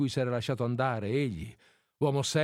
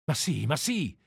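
feverish agitation, lightly acted; feverish outburst; 2 semitones higher than usual. A narrator's reading. Woman confessing to her lover, mildly feverish. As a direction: burst in with a touch of fever. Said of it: "riprese ella con febbre".

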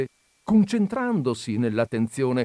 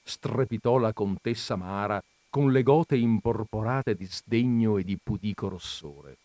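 usual speaking voice

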